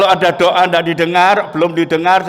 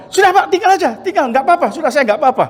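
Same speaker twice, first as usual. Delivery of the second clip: higher, eager it's fine, go; reassuring the driver; 7 semitones higher, much faster than usual. Retold or imitated. Injured man telling the frightened driver to go. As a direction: wave off the scared driver's worry, lifted voice. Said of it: "sopirnya di angkatan laut juga takut dia".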